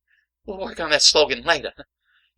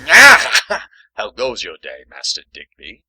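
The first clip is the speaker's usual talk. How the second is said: mimicking Caleb; 9 semitones lower, 6 dB louder than usual. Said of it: "makes me say".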